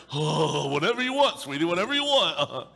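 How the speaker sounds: a man giving in to a woman, gushing; smitten man. Retold or imitated. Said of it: "It's like".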